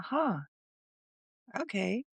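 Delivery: high, doubtful huh, then a quiet okay; taken aback but going along; surprised huh. Retold or imitated. Unsure reply to the doctor's brush-off, surprised. Doctor downplays her bleeding.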